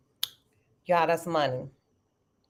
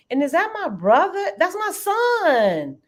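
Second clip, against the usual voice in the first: touched surprise; 9 semitones higher, 3 dB louder than usual. Real, not acted. Sees her son in chat.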